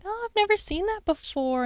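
airy mock-casual discovery; feigned casual surprise. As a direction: play innocent, as if just noticing it. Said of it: "casually set something out and be like".

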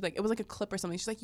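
ordinary talk

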